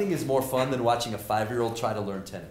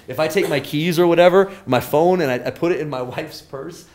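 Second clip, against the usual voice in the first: much louder, building indignation; animated; 5 semitones higher, 12 dB louder than usual. Real, not acted. Professor joking about losing keys in wife's purse, loud. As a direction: rant it with comic exasperation.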